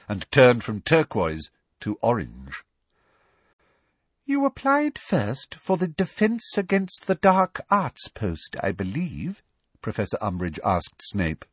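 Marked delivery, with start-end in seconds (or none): 4.3-9.4 prim high lady voice. Acted. Umbridge questioning Snape.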